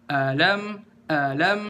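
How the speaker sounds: "Alam" with a full "A" and rising "lam"; differentiated intonation. Shown as a demonstration. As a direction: set "A" apart, then lift "lam" unhurriedly. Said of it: "intonasi dibedain antara a sama lam".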